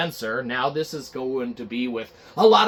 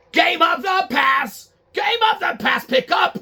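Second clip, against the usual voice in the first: much higher, louder bark into the phone; shouting into phone; 13 semitones higher, 5 dB louder than usual. Acted. Phil Spencer yelling into his office phone, high and loud. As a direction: yell down the line for them to pick up. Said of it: "he picks up his office phone".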